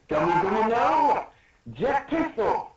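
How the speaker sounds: high, rising battle yell; hammed-up anime attack cry; acted attack call. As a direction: shout out the special move, pitched high.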